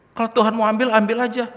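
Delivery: high, loud retold quote; emphatic requote. Son repeating mother's shocking prayer, emphatic requote.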